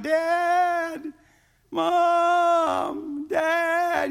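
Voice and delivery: long, high drawn-out calls; crying out for parents. Retold or imitated. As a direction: holler for Mom and Dad, stretching each word. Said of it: "I cried out".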